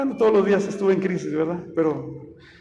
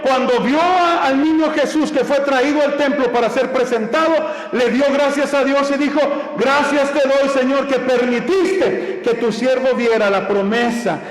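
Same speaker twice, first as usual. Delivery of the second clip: higher, insistent sermon voice; preaching at full force; 3 semitones higher than usual. Acted. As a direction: drive the message home to the faithful. (predicación con toda la fuerza, voz más alta)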